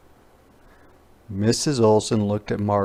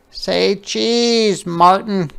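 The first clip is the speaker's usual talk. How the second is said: put-on high little girl voice, cheerful; a small girl snapping photos, high and bright; little girl playing photographer; Ruthie's voice; 9 semitones higher, 5 dB louder, much slower than usual.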